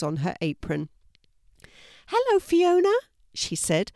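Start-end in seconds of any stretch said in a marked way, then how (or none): 2.1-2.9 put-on much higher, louder old lady; lightly voiced kindly old neighbour; elderly lady voice. A narrator's reading. Missus Berry greeting Fiona at her gate, high old lady voice.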